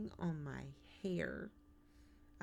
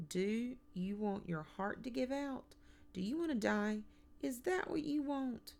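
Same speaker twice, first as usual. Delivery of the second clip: higher-pitched, insistent rhetorical questions; exasperated scolding; 7 semitones higher than usual. A narrator's reading. Mother warns son about his heart, scolding. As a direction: hammer each question, climbing in pitch.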